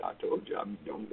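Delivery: a soft unvoiced whisper; preacher whispering to a listener at judgment day; whispered aside. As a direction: lean in and whisper it.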